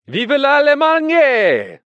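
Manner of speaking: very high, loud, long-drawn cry; taunting shout. A narrator's reading. Isaiah baits Furex from out of reach, taunting shout. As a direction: yell it out to bait him, stretching every syllable. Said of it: "while Isaiah shouted".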